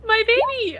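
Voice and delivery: high, loud wail over a lost horn; a high, pained cry of playful grief; mock pained wail. Real, not acted. Player reacts to losing horn.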